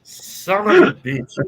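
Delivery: a slow, loud, high son of a bitch as the prank lands; exasperated outburst. Real, not acted. Tricked listener groaning at the storyteller's fake ending. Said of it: "My heart was melting for a minute".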